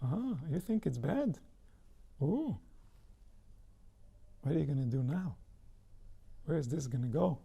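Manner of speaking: hushed, teasing inner tempter; soft and sly, egging on the doubt; a quiet creeping voice that feeds the fear; ominous inner voice. Pretend. Taunting inner voice replies.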